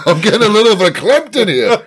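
put-on high, loud Yiddish accent through laughs; laughing Yiddish accent, verklempt. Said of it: "Where's this accent coming from?".

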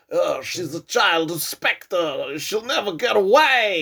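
slow, drawn-out villain voice rising at the end; hammed-up villain accent. Henchman with robot eye declaring she'll never escape, hammed-up villain accent. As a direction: drag it out like a hammy henchman with an accent. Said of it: "I'm not sure why he's that accent".

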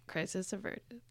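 talking through burps; reading while burping. Real, not acted. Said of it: "I'm burping, sorry".

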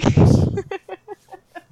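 high burst of laughter. Real, not acted. Laughs at goodest boy.

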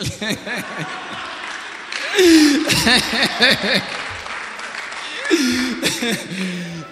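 a long high, loud laugh. Real, not acted. Guest laughing at the emotional pastor, long loud laugh.

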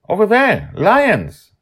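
high, loud excited cry. A narrator's reading. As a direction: point out the lions eagerly. Madeline spots lions.

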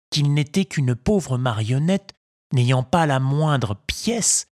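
plain reading voice

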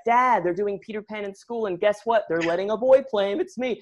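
put-on high, eager child's voice; excited kid. Boy telling his dad about the school play, excited.

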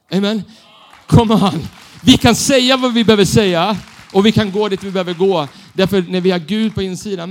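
loud fired-up preacher call; full preaching. Acted. Preacher rousing the church, full force. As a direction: rouse the congregation, full voice.

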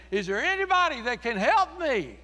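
loud, high pleading shout; desperate cry for help. Retold or imitated. Condemned man dragged toward hell, a loud desperate plea. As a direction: cry out as if the heat is closing in.

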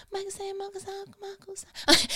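high-pitched, wispy mock voice. Singer caught on the phone switching back, mock high-pitched voice. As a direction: jump up into a tiny falsetto. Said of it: "went back high-pitched".

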